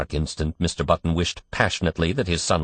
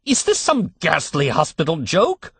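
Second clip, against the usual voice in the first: higher, outraged demand; terror turning to rage; 11 semitones higher, 3 dB louder than usual. A narrator's reading. Father accusing the hospital staff, raging. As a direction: let the fear boil over into anger. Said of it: "his terror resolving into rage".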